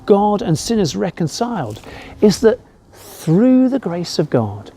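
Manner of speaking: normal talking voice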